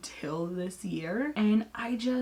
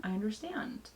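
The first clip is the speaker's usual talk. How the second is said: I understand dropped much softer; softer repeat; 6 dB quieter than usual. Real, not acted. Repeating herself to her husband, understand said softer. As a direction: let I understand fall away quietly. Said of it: "repeats I understand".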